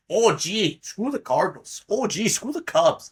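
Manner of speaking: hammed-up Minnesota accent, pitched high; mock-polite Minnesota "oh gee" accent; terrible Minnesota accent. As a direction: do a bad Midwestern, Minnesota voice. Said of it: "a terrible Minnesota accent".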